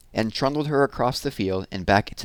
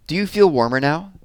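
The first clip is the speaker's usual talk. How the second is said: called out; 8 semitones higher, 4 dB louder than usual. Acted. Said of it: "they called".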